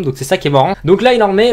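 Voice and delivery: ordinary talk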